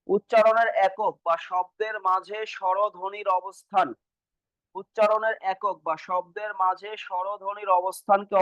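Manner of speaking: slow, even read-back of a definition; slow dictation. Shown as a demonstration. Teacher repeating the definition for students, slow dictation. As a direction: read it out word by word for note-takers.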